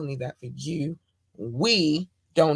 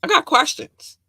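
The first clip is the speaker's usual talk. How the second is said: indignant outburst; 10 semitones higher, 7 dB louder than usual. Real, not acted. Ranting about staying in lane.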